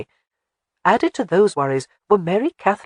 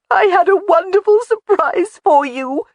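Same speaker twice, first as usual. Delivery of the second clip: high, loud tearful wail; 14 semitones higher, 7 dB louder than usual. Acted. Jamie wailing to Alec her surprise is spoiled, high and loud. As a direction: cry it out, voice way up. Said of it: "she wailed".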